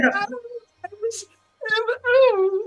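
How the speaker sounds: high stammering elf; flustered high-pitched elf woman. Acted.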